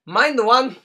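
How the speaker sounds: mock German accent on "Mine the one". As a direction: say it like a jokey German. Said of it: "It's my German accent".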